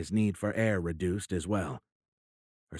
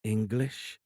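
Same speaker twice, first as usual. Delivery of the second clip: put-on lightly mechanical South African-like accent; one word with a hint of South African accent; odd South African-like accent; 2 semitones higher than usual. A narrator's reading. Alien naming the captive's language, odd South African-like accent. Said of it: "The odd accent sounded like that of a South African".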